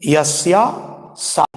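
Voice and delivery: normal talking voice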